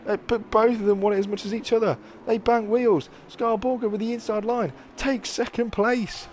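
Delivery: high, excited final-lap commentary; motorsport commentator. Acted. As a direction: build the hype to the finish. Live race play-by-play.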